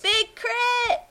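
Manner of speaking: high excited shout of the name; thrilled, calling out the name. Real, not acted.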